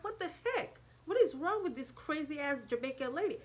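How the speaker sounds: high-pitched retold exclamation of disbelief; outraged disbelief. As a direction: exclaim in high indignation, replaying the shock. Retold outrage as car drives off.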